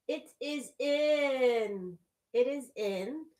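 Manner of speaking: high, slow, loud and thrilled; excited. Real, not acted. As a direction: announce it with delight. Excited reveal of new hoop.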